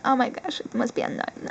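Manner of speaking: usual speaking voice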